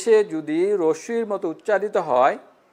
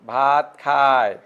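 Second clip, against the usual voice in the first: slow, খায় held long at the end; drawn-out ending; 4 semitones lower, much slower than usual. Shown as a demonstration. Teacher dictating an example, খায় drawn out. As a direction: stretch খায় into its final য়. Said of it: "টানে উচ্চারণ করো".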